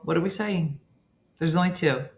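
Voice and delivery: ordinary talk, nothing marked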